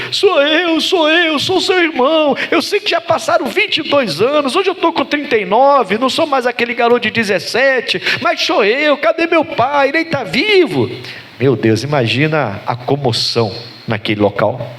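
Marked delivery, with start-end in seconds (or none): 0.1-10.9 sustained high-pitched tearful wail; talking while crying. Acted. Joseph pleading with his brothers to recognise him, crying throughout. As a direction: act out pleading through sobs, voice held very high. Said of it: "ouviu o choro dele".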